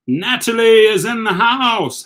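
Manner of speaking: big slow welcome call, voice lifted; excited greeting. Real, not acted. Host welcoming Natalie into the live stream, excited call. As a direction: hail her arrival like an announcer.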